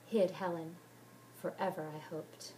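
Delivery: ordinary delivery, nothing marked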